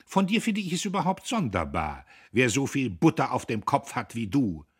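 high, loud, superior accusation; haughty. A narrator's reading. Käthchen gains the upper hand over Guste, haughty. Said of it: "Sie warf den Kopf zurück".

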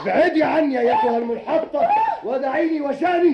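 very high, loud raging scream; furious. Acted. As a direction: shout insults as you break away. Husband breaking away in rage. (بصراخ غاضب هائج)

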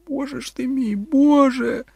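very high wail, swelling at the end; sob, breaking into a cry; sobbing. A narrator's reading. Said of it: "схлипувала Кетрін". (схлипуючи, з розпачем)

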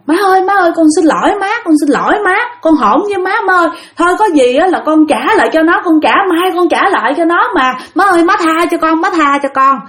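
high, pleading cries for mercy; a choking man pleading with his mother's spirit; desperate begging. Retold or imitated. Choking man begs mother's ghost.

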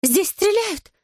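very high-pitched woman's squeal; panicked shriek. A narrator's reading. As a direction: shriek the alarm like a frightened bystander. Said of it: "взвизгнула неподалёку какая-то женщина". (испуганный женский визг)

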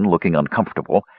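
plain reading voice

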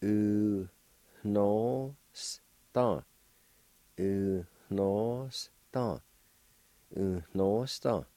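U, no, sta with a nasal N and a faint H after the U; nasalized N. Shown as a demonstration. Teacher drilling dollar for learners, nasal N stressed. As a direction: say each piece separately, letting the N go through the nose. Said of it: "The N sound is nasalized, like this".

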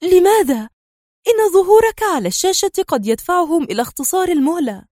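high, anxious and quick; worried. A narrator's reading. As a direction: ask him fast, alarmed. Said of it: "سألته في قلق".

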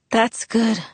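understated lifeless reply; dead voice. A narrator's reading. Sick boy answering his friend's good news, lifeless. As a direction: answer with the energy drained out. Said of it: "His voice was dead".

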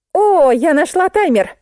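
high-pitched happy discovery; triumphant announcement. A narrator's reading. Musenka tells narrator she found the timer, triumphant. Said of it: "возвестила Мусенька".

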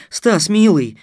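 high, emotional call to a loved one; loud, anxious endearment; trembling voice. A narrator's reading. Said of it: "повторила Дарья Первая с дрожью в голосе".

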